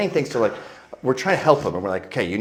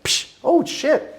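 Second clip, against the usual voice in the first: mock pained yelp; much slower than usual. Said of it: "I'm like".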